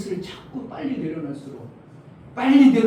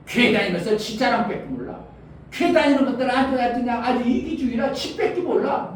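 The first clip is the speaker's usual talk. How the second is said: higher-pitched imitation of sneering outsiders; mocking scoffers; 6 semitones higher than usual. Outsiders ridiculing churchgoers as selfish, mocking. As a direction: mimic neighbours jeering at churchgoers. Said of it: "다 사람들이 비웃습니다".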